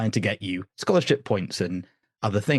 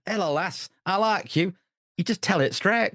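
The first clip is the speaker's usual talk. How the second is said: higher-pitched demo of northern English; northern English accent; 8 semitones higher than usual. Shown as a demonstration. Guest showing how family up north talk, northern English accent. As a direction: greet her in straight-talking northern English. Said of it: "ignore the accents".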